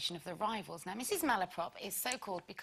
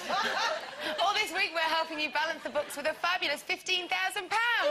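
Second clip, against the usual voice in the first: loud, high game-show announcement; hammed-up competition link, raised and loud; presenter's competition read; 9 semitones higher, 5 dB louder than usual. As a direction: announce the prize with big showy lift.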